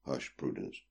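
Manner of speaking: soft, low hush; quiet her down. A narrator's reading. Brother hushing sister.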